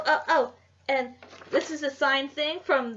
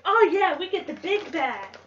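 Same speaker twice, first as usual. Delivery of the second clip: excited; 6 semitones higher, 5 dB louder than usual. Retold or imitated. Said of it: "I was like".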